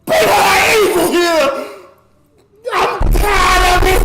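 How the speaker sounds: sobbing, high and loud yell; crying shout. Real, not acted. Streamer venting to chat about evil, crying shout. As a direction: shout through real tears.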